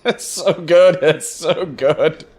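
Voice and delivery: laughing through the words, then slowing; giggling delight at awful prose; amused laugh breaking into speech. Real, not acted. Laughing at the bad story.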